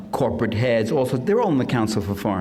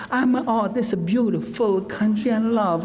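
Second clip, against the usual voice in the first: put-on higher, hammy movie-Italian accent; old-movie fake Italian accent, gushing; 10 semitones higher than usual. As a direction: ham up a bad film Italian accent. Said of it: "no Italian had an accent like that".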